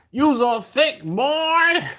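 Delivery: high, loud, drawn-out catchphrase; TikTok catchphrase quote. Acted. Streamer quoting a TikTok line to viewers, drawn-out high catchphrase. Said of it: "know what that's from".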